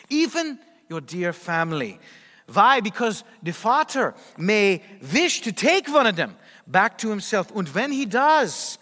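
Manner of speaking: imitated Dutch-accented old saint's warning; thick Dutch accent. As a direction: put on a heavy Dutch accent, deliberate phrasing. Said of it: "in her thick Dutch accent, she said".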